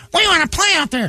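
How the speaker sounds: high-pitched mock whine; squeaky put-on plea; mocking mimicry. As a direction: mimic them in a silly high voice. Mimicking women wanting to play golf.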